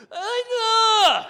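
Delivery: sudden high shrieking yelp. Retold or imitated. Comic thrown against the wall, sudden high shriek. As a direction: let out a startled high squeal. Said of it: "a sound I can only describe as".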